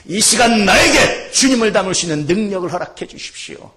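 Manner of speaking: fervent prayer. Real, not acted. Preacher pleading with God to be like Jesus, fervent prayer. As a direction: pray it out with rising force.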